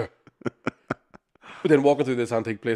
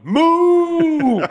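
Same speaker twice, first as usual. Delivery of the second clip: loud high mooing at the cows; imitating a cow out the window; mock cow moo; 11 dB louder than usual. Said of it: "the woman had the window down going".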